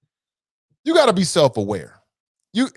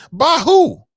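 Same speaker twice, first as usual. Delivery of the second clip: scoffing outburst; 10 semitones higher, 6 dB louder than usual. Real, not acted. Scoffing at the senator's claim.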